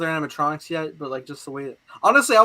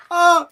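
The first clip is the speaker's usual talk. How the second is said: retold yelp; 13 semitones higher, 10 dB louder than usual. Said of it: "I think I yelped".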